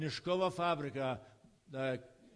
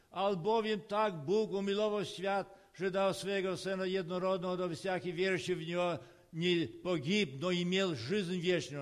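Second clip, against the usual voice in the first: retold open-air preaching; 2 semitones higher, 3 dB louder than usual. Preacher retelling his Red Square sermon, calling out to passers-by in raised proclaiming voice.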